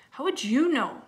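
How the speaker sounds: high, loud challenge; indignant retort. Acted. Little sister answering her doubting brother, indignant. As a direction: throw it back at him, high and loud.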